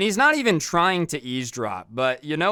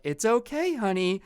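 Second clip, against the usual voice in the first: mock sweet parent; 6 semitones higher than usual. Parents mock-soothe screaming kid.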